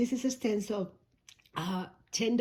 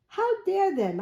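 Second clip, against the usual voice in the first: mock outrage; 10 semitones higher, 4 dB louder than usual. Mock outrage over boxed toy.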